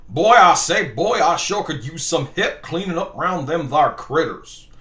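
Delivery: booming start, then slow Southern drawl; hammed-up Southern colonel accent; cartoon Southern accent. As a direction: bluster like a Foghorn Leghorn Southerner. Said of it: "I'm allowed to do that accent".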